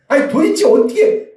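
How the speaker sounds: mock exasperation. Shown as a demonstration. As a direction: snap at a family member's mistake. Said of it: "이 도대체 어떻게가 아니라". (가족의 실수에 짜증 내는 목소리)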